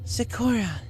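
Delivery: high excited call; sudden idea, blurt it; lit-up exclamation; exclaiming a name. Acted. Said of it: "she exclaimed".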